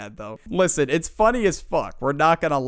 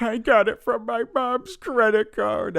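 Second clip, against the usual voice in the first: put-on sheepish kid; 7 semitones higher than usual. Kid confesses.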